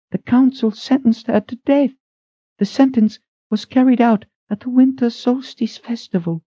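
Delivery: high, brisk woman's voice; thin head cook. A narrator's reading. Head cook rushes in asking who calls, high. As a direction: ask it sharp and high.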